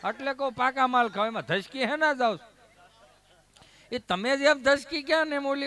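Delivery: loud rousing preacher's exhortation; preaching at full force. Acted. Storyteller urges the crowd to eat wholesome food, preaching loudly. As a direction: drive the lesson home to the crowd, booming.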